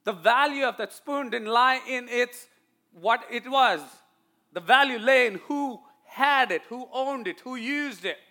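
high, loud, punched key phrases; emphatic preaching. Real, not acted. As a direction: preach the point with mounting insistence. Preaches the point of the story.

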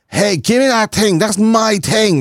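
put-on high petulant toddler claim; bratty toddler. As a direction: squeal it like a toddler snatching a toy. Toddler voiced grabbing toy.

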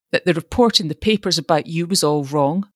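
plain reading voice

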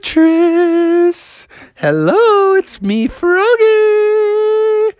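very high, drawn-out lovesick call; high singsong besotted greeting; lovestruck frog. Acted. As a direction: swoon it out in a high falsetto. Smitten frog greets his love.

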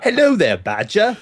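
put-on lightly done posh British accent. Answering a fan's request for a British accent, light mock British. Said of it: "do your best American accent badge and British accent".

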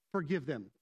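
imitating a gentle inner voice. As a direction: say it low and gently, without blame. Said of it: "and this gentle voice".

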